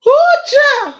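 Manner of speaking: very high squeal of laughter; laughing squeal. Real, not acted. After long laughing fit.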